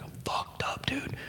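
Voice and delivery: unvoiced, very soft whisper; whispered confession. High man confessing to buddy, whispered. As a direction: confide it under your breath. Confides to friend in story.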